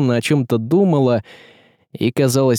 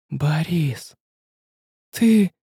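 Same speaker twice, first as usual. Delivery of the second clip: gasped; 5 semitones higher, much slower than usual. A narrator's reading. Tsaritsa learning her husband's guilt, gasping. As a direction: breathe it out in disbelief. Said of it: "ахнула царица".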